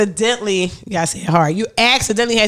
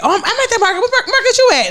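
fake innocent voice; 11 semitones higher, faster than usual. Faking an alibi on the phone.

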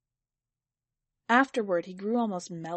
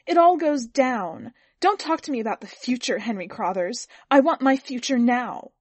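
higher, loud, agitated wife arguing back; impassioned, raised-voice argument; trembling outburst; 4 semitones higher, 8 dB louder than usual. A narrator's reading. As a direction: burst out in heated protest.

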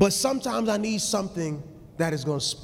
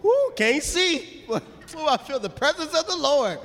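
mock weepy worshipper; 13 semitones higher than usual. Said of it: "Your eyes are full of water".

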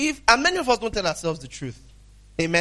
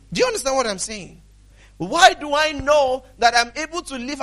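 raised, ringing sermon delivery; emphatic preaching; 6 semitones higher, 5 dB louder than usual. Real, not acted. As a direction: drive the scripture home to the congregation. Preaches on the scripture.

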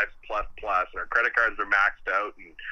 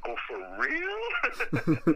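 mock surprise; 5 semitones higher, much slower than usual. Retold surprise at phone calls.

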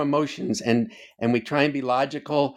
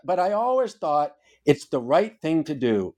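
ordinary delivery

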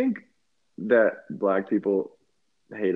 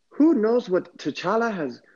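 a put-on Wakandan accent, raised pitch; 6 semitones higher than usual. Joking with friend about T'Challa, Wakandan accent. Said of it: "that's a good Wakandan accent".

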